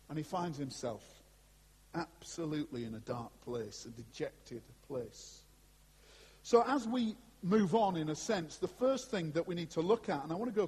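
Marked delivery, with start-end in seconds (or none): 0.1-5.4 hushed and somber. Acted. Describing dark, dejected place.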